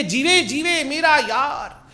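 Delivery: high, drawn-out imitation of fawning devotion; mimicked adoring chant, stretched out and high; mock gushing affection. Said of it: "कह रहे थे बाजवा जी से गले मिलके".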